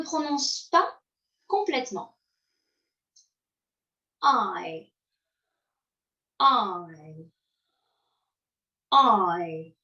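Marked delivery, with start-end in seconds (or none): 4.2-9.8 "I" gliding from A to a light closing sound; teacher's model diphthong. Shown as a demonstration. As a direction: slide through the "I" glide. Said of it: "Répétez".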